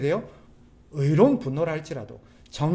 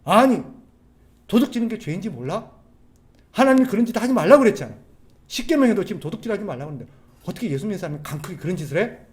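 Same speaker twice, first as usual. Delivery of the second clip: raised, fast parental telling-off; angry scolding; 4 semitones higher, 6 dB louder than usual. Acted. Parent to a child who stole, angrily scolding. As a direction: scold hard, piling up the reproaches. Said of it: "화를 내야죠".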